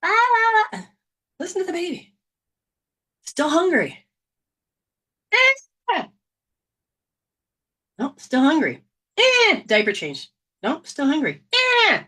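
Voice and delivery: put-on high baby whines between words; fussing baby. Hungry baby fussing at parents, high whiny cries.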